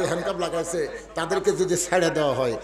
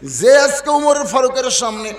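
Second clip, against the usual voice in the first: outspoken outcry; 6 semitones higher, 8 dB louder than usual. Acted. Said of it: "সমস্ত সাহাবীরা জবান খুলে বলতেছে".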